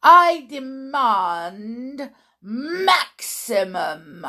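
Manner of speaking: put-on slow, emphatic witch with a foreign accent and drawn-out words; tyrannical witch. Witch leader gives orders to her audience, slow imperious accent. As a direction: relish each word, rolling the R's.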